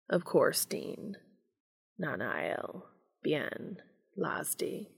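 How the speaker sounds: soft murmur, word by word; muttered. A narrator's reading. Castiel kissing along Dean's neck, muttering. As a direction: murmur each word separately, fading toward a whisper. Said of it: "Castiel mutters each foreign word".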